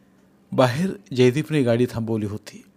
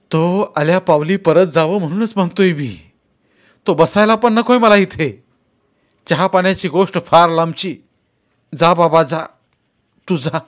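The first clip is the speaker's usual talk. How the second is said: angry; 6 semitones higher, 7 dB louder than usual. Acted. Father angrily sends son away.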